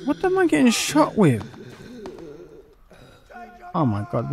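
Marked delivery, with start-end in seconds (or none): 0.0-1.4 alarmed high cry. Real, not acted. Under fire.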